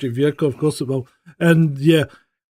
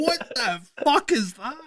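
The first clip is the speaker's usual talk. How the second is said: high, drawn-out mock disbelief; incredulous and slow, pitched well up; retold bewildered outburst; 9 semitones higher, 5 dB quieter, slower than usual. Owner reacting to phone suddenly talking, bewildered outburst.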